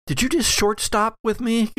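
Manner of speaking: put-on high, girlish quoted question; Allison's voice. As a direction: pitch up for a startled young woman. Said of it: "Allison says".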